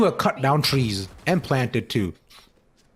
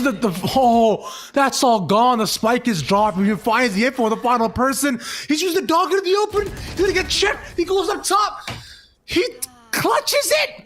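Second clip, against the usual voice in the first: rising excited play-by-play shout; esports caster; 10 semitones higher than usual. Real, not acted. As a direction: yell the clutch call, climbing higher. Clutch round ends the match.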